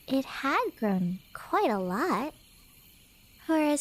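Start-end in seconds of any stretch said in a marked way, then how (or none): none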